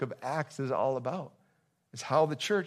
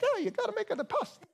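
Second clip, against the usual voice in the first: very high, hammed-up Italian accent; terrible Italian accent. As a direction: do a bad cartoon Italian accent. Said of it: "That's the worst Italian accent ever".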